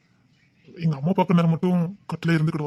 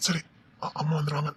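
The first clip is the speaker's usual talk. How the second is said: soft, furtive whisper; hushed whisper; 7 dB quieter than usual. Acted. Man warns the woman his mother is coming, whispering. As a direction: whisper so nobody overhears. Afraid mother will walk in.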